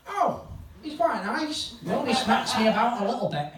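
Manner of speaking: high-pitched put-on woman's voice; fond woman, imitated. Woman fondly describes Ricky, acted.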